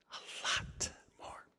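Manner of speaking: a knowing whisper. Real, not acted. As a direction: breathe it out as a secret. Aside after claiming he knows.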